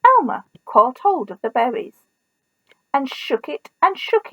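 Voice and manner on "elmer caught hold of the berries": ordinary delivery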